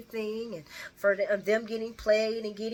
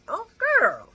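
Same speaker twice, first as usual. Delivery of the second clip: sassy; 8 dB louder than usual. Real, not acted. Teasing Brooklyn.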